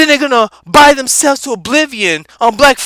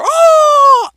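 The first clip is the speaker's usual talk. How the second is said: soaring oh; 5 dB louder than usual. Acted. Eagle soaring exclamation.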